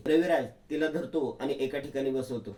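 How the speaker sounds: normal talking voice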